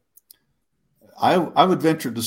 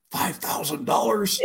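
slow, high, disbelieving question; slow, high stunned whisper of disbelief; 9 semitones higher, 4 dB quieter than usual. Retold or imitated. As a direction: say it like you can't believe your luck. Disbelief after hanging up.

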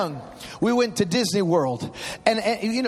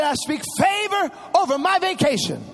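put-on six-year-old boldly praying in the park; bold child prayer; 5 semitones higher than usual. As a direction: declare it like a tiny preacher. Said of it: "He said".

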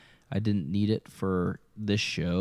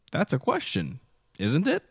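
"isn't it?" pitched up into a rise; playful inflection; 6 semitones higher than usual. Shown as a demonstration. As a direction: lift "it" high at the end of "isn't it?". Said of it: "it's all about the inflection".

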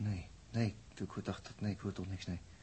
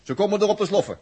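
loud call; 7 semitones higher, 9 dB louder than usual. Acted. Announcing loudly across the room.

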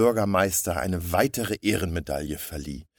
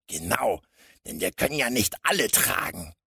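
higher-pitched crook, mildly grumbling; grumbling henchman; 5 semitones higher than usual. A narrator's reading. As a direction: grumble along like a burly accomplice. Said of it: "murrte der Stämmige". (murrender Handlanger)

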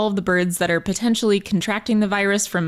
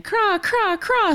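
much higher, rolling raven call; raven craw; 11 semitones higher, much slower than usual. Retold or imitated. Host voicing the raven in her poem, higher craw. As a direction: squawk it like a raven. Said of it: "says the raven".

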